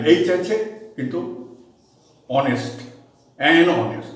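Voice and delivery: ordinary delivery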